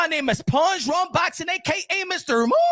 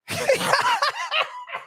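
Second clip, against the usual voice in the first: shrill laugh burst. Real, not acted. Loud high laugh.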